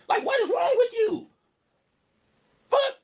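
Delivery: high, drawn-out disbelief; incredulous scolding. Acted. Scolding the girl who hit him, high and incredulous. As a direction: scold her in high outrage.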